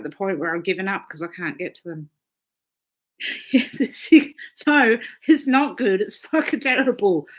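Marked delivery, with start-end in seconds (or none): 3.2-7.3 laughing. Real, not acted. Laughing through a coughing fit.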